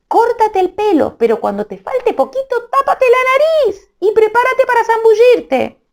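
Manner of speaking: very high, small little-man voice; tiny voice. Acted. Tiny Amadís comforting crying Melisenda from her ear: very high squeak.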